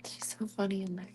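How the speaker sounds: soft unvoiced whisper. Real, not acted. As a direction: whisper it so the cats stay calm. Whispering not to disturb kittens.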